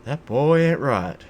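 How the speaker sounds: slow, loud cartoon-dad impression; hammed-up TV dad catchphrase; Hank Hill. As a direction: pitch it up and draw out each word. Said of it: "in a Hank Hill voice".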